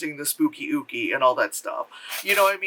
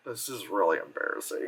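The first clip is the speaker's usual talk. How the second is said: low, soft cringe; hushed, uncomfortable remark; embarrassed aside; 10 semitones lower, 8 dB quieter than usual. Retold or imitated. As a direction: say it quietly, dropping low in secondhand embarrassment. Viewer cringing at the acting.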